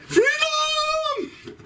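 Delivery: high, far-off Scottish battle cry of Freedom; Braveheart Scottish yell. Retold or imitated. Comic doing Mel Gibson's Braveheart yell, Scottish accent. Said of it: "I gotta work on that accent there".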